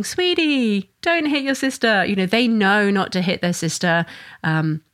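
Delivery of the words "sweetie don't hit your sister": calm and gentle. Retold or imitated. Parent calmly stopping a child hitting her sister, gentle. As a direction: gently tell the child to stop, pitch up. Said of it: "moving in rationally and calmly and saying".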